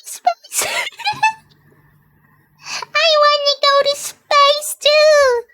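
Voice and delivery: squeaky high cartoon voice. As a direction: pipe up high and eager like a little character. Avatar voice excited about space.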